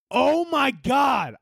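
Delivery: high, drawn-out shout; exasperated outburst. Pretend. Passenger with a kid kicking his seat, exasperated shout. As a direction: stretch it into a high yell.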